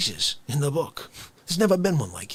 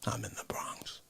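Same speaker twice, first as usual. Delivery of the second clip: low, soft whispered narration; 12 semitones lower, 9 dB quieter than usual. Acted. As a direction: hush it like a secret. Whispered absurd scenario.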